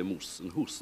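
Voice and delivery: moose and hoose, long oo vowels; Scottish moose and hoose. Shown as a demonstration. Explaining Scottish speech: moose and hoose, long oo. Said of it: "in Scotland, they still say".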